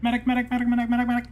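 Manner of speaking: rapid high repeated medic calls; man down shout. Acted. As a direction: keep yelling for a medic.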